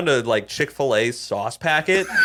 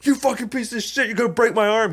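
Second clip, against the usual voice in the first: much higher, put-on yelping rage; mock pained fury; 10 semitones higher than usual. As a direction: play the guy howling with his arm stuck. Man's arm caught in door.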